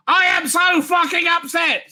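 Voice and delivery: slow, high hammed-up shout of upset; mock furious shout. Reading a fan's angry tweet aloud, mock furious shout.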